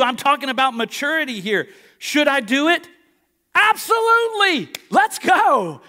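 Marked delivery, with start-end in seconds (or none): 2.0-5.8 jumps high and loud into an eager yes; hyped yes. Retold or imitated. Preacher answering his own question with a loud, hyped yes.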